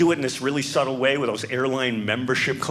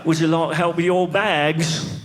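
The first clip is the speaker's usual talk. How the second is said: put-on British accent, slow and higher; 4 semitones higher, 4 dB louder, slower than usual. Brit greeting a newly landed visitor, British accent. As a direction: exaggerate a polite British accent, drawn out. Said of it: "everyone is doing a British accent over there".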